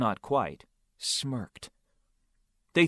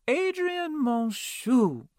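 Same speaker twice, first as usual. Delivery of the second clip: much higher, sing-song French-flavoured greeting; Claude fluting; 13 semitones higher, much slower than usual. A narrator's reading. Café owner greeting Adrian on the phone, high and fluting. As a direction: flute his name up high and sweet. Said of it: "fluted the high, clear voice".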